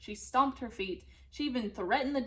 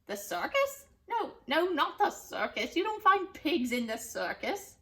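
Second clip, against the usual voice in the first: scoffing witch; 6 semitones higher, 3 dB louder than usual. Acted. Witch rejects idea.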